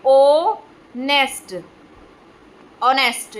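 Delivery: ऑनेस्ट drawn out very slowly, h silent; teacher stretching ऑनेस्ट syllable by syllable; slow model pronunciation. Shown as a demonstration. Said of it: "इसलिए हम इसको क्या बोलते हैं".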